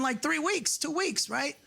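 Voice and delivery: ordinary talk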